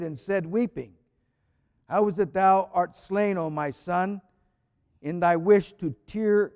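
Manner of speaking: reading the Theotokion aloud to the congregation; solemn hymn reading. Acted. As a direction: read the hymn out with solemn fervour. Priest reads hymn aloud.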